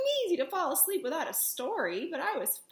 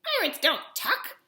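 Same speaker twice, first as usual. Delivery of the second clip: loud dismissive pirate line; flat refusal from a rough captain; gruff pirate refusal; 4 dB louder than usual. Acted.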